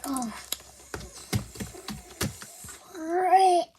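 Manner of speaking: drawn-out, halting exclamation; acted stumble into a mild curse; stuttered near-swear. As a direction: stall on the first sound, then let it out. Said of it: "so you have a stutter".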